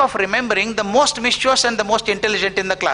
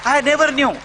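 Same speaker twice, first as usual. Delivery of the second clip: emphatic exclamation; 8 semitones higher than usual. Real, not acted. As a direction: hammer the point home with wonder. Realizes the power of ten minutes.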